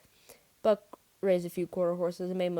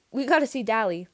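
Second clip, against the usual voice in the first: higher, louder, nervous insistence; urgent plea; 4 semitones higher, 8 dB louder than usual. A narrator's reading. Nervous boy pleading at door.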